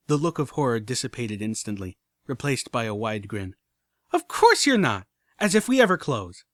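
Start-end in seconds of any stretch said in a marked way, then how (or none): 4.1-6.4 high, loud giggly delight; a grinning filly teasing; gleeful filly. Acted. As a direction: bubble over with glee. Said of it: "She giggled exuberantly".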